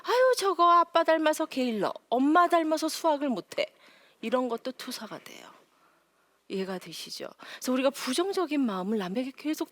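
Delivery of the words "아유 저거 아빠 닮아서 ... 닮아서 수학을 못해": mild mock scold, higher and louder; imitating scolding. Mother scolding at home, mock irritated nagging. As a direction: nag like an irritated mom at home. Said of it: "막 화를 내는 거죠".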